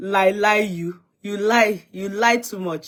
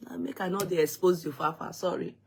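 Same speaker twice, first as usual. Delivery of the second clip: ordinary delivery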